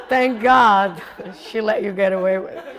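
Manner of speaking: loud, laughing thank God; laughing relief. Retold or imitated. Written note to the Indian guy, laughing loudly.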